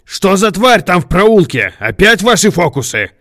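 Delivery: high, loud accusing yell; furious high-pitched questioning; angry shout. A narrator's reading. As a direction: shout it at a rival in outrage. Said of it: "крикнул он".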